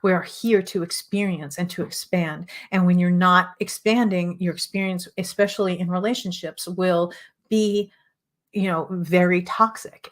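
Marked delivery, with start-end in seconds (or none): none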